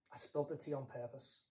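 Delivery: low, very soft admission; guilty confession. Acted. Friend calls to confess about laptop, low and hushed. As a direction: own up quietly, ashamed.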